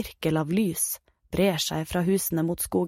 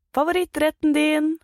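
higher, louder coaxing mum voice; cheery mother; 9 semitones higher, 4 dB louder than usual. Acted. Mum coaxes her son with his favourite dish, bright and eager. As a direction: sell the treat like a guilty mum.

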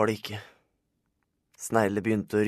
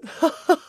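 high, loud character laugh; acted laugh; 8 dB louder than usual. Laugh after a character's line.

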